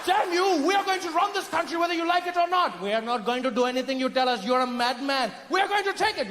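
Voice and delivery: high, outraged British voice; furious British colonizer. Acted. Colonizer swears to seize the country, furious. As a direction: declare it in blustering fury.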